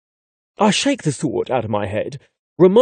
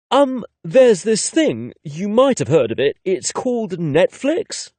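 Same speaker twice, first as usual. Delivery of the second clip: sarcastic tease; 6 semitones higher than usual. A narrator's reading. Narrator teases the older guy, sarcastic. Teasing reply about his age.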